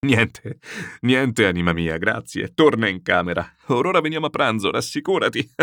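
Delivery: loud words pushed out through hilarity; struggling to speak from laughing. A narrator's reading. General reassuring his frightened daughter, helpless with laughter. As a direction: try to reassure, then burst out laughing. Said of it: "prima di poter articolare una sillaba".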